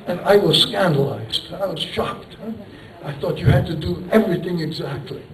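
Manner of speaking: ordinary delivery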